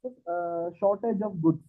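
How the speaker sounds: faint, far from the mic; barely audible. Real, not acted. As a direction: voice fading away from the mic. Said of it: "why your voice is very low".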